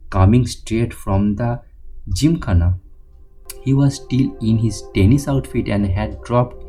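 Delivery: usual speaking voice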